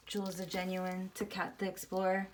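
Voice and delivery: ordinary delivery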